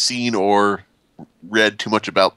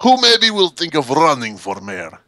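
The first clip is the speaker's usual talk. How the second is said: slow, higher mock Russian question; bad Russian accent; 4 semitones higher than usual. Russian player seeking a candidate for mayor, bad Russian accent. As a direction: put on a fake Russian accent. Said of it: "very bad attempt at a Russian accent".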